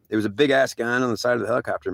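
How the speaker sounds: usual speaking voice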